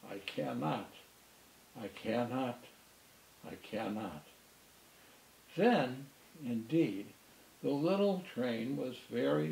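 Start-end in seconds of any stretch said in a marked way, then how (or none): 0.1-4.2 softer and lower each time, fading off; fading chug. A narrator's reading. Old engine rumbling off to the roundhouse, fading chant. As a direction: chug it away into the distance.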